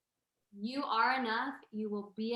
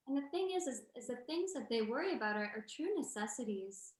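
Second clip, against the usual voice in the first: ordinary talk, nothing marked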